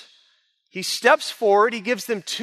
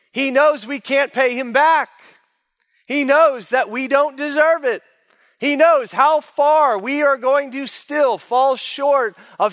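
higher and louder, driving each "He knows" home; preaching at full force; 4 semitones higher, 4 dB louder than usual. Acted. Preacher pressing the point on his listeners, at full force. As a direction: preach it with rising fervour to the congregation.

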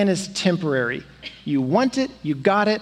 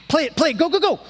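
urgent urging; 7 semitones higher, much faster than usual. Retold or imitated. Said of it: "And he's like".

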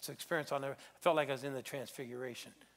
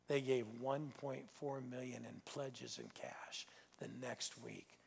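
slow, softer hushed reveal; awed whisper; 2 semitones lower, 8 dB quieter, slower than usual. Acted. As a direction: draw them in with a whisper. Dramatic reveal to congregation.

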